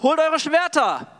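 hoch und laut rufen: Schwerter holen; Befehlsruf. Acted. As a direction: ruf deine Männer zu den Waffen. David befiehlt Angriff. (lauter, hoher Befehlsruf)

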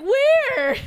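loud, very high "where"; loud blurted question. Retold or imitated. Friend imagining giving the secret away, loud blurt. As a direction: blurt it out far too loudly. Hypothetical blurting out at pointed-out stranger.

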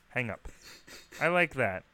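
normal talking voice

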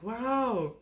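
high, awed little wow; a small child's sudden realization; childlike wow. Retold or imitated. Six-year-old gasps at the other grandparents.

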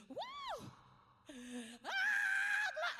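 high shouted woo of praise; joyful whoop. Retold or imitated. Pastor imitating a joyful church shout. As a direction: let out a big church whoop.

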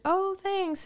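playing it cool. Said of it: "I tried to play it off cool".

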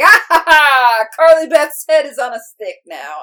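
high, loud cackling villain crow; gleeful mock-evil taunt, loud and high; maniacal character voice. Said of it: "The voice and the laugh is wonderful".

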